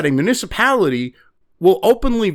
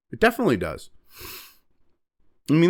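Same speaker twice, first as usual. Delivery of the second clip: ordinary talk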